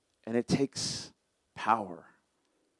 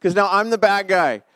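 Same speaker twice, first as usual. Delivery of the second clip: higher, louder mock whine of a husband crying foul; whiny protest; 7 semitones higher, 5 dB louder than usual. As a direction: play the aggrieved husband, half-joking. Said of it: "And I'm like".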